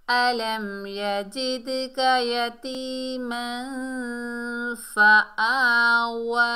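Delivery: slow, drawn-out melodic chant; Quran recitation. Shown as a demonstration. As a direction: recite the verse slowly with long held vowels. Next verse recited.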